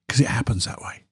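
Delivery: whispered aside; half-whispered, just for the listener. Real, not acted. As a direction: drop to a breathy confiding whisper. Confiding aside about kindness spreading.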